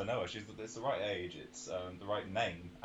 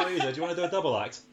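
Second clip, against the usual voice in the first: fast excited laughing; 6 semitones higher, 7 dB louder, faster than usual. Real, not acted. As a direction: rush it out giggling.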